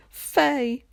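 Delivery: sudden high cry of a name; shriek. A narrator's reading. Aunt Gloria greeting her sister with a high shriek. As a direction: shriek the name across the room. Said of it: "Aunt Gloria shrieked".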